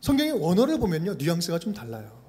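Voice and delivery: ordinary talk, nothing marked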